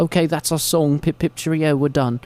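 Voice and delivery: tidy cartoon British frontman; British accent. British band ending its short song, mock British accent. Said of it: "British guys".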